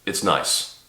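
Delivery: usual speaking voice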